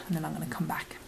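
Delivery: whispering. Acted. Whispered aside.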